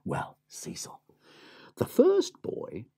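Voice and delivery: whispered aside. A narrator's reading. Narrator whispers to Cecil. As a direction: lean in and whisper his name.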